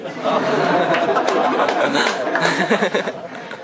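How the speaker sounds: audience laughing; crowd laughter. Real, not acted. Crowd laughing at Mufasa joke.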